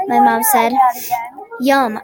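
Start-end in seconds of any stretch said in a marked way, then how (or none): none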